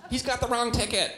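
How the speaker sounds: put-on high whiny know-it-all kid; annoying kid voice. Imitating the Polar Express kid, high whiny tattle. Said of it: "the voice was like".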